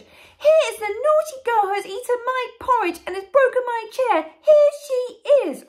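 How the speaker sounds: very high, loud cub squeal; loud tiny Baby Bear. Acted. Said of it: "tiny little voice as loud as he could".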